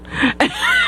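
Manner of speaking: a sudden high shriek of laughter; high squealing laugh. Real, not acted. Laughing at her friend's much younger boyfriend.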